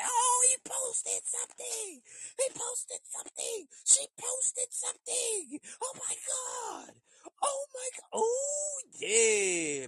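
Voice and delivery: mock squealing fan, very high-pitched; a hammed-up, sky-high excited fan voice; obsessed fan squeal.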